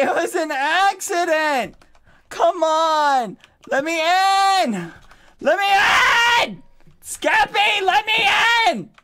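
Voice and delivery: high, loud wailing plea; crying shout. Real, not acted. Begging Skeppy to open the door, high crying shouts. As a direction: scream to be let in, near tears.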